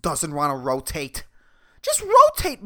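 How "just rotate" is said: high angry yell at the planet; mock rage. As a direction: scream the order at the earth. Yelling at the earth.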